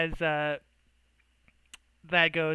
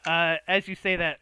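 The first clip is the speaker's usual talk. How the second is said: ordinary talk, nothing marked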